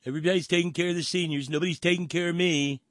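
high, put-on complaining voice; high mocking parody of a complaint; mock self-pity. Mockingly quotes the clip's self-pity.

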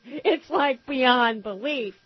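ordinary talk

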